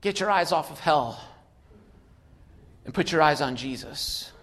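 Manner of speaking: ordinary delivery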